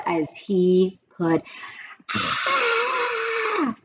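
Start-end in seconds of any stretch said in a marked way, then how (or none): 2.1-3.8 short high yelp of pain; high scream. A narrator's reading. Leslie shrieks when Paul yanks her pigtail. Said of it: "screamed Leslie".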